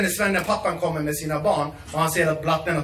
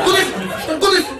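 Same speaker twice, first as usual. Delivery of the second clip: very high, loud shooing; shooing yell; 12 semitones higher, 5 dB louder than usual. Retold or imitated. Elder uncle shooing a young man from the grill, loud high yell. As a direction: chase him off the grill at full voice.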